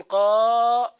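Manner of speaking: قاضي called out loud, the long a stretched; demonstrating pronunciation. Shown as a demonstration. Teacher modelling the original قاضي for students. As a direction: sound out قاضي for the class. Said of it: "تقول".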